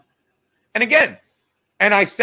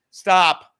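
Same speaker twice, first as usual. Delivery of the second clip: loud stop; 8 dB louder than usual. Real, not acted. Scolding viewers to stop.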